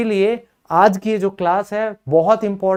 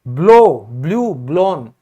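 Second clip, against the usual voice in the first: Blow punched loud, then blue, blown lower; verb forms stressed; 4 semitones lower, 4 dB louder, slower than usual. Shown as a demonstration. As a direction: hammer the blow form home. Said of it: "धमाका यानी कि blast".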